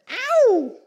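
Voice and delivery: high, loud falsetto "Ow!"; a sudden squeal of delight; playful whoop. Real, not acted. Preacher's playful exclamation.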